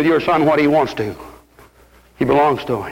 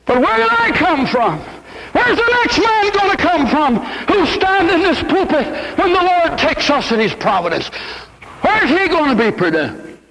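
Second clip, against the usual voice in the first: preaching at full force; 10 semitones higher than usual. Acted. Preacher rousing his congregation to prayer and preaching, full-force sermon cry. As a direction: call the whole church to prayer.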